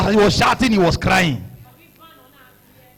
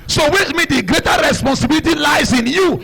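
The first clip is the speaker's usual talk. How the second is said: preaching; 7 semitones higher than usual. Acted.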